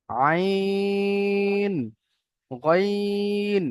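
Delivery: আইন and গইন each held very long on a level, chanted note; drawn-out recitation. Shown as a demonstration. Teacher introduces the next line's letters, drawn-out recitation. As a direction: stretch the end of আইন, then গইন, in a sustained chant.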